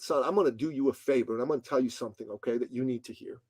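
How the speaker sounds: put-on quiet, level advice from an older man; quietly imitating a mentor. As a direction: say it low and calm, man to man. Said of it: "He was much more quiet than I was".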